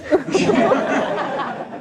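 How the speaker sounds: a high, loud burst of laughter; big laugh. Real, not acted. Storyteller bursting into laughter at the film shoot.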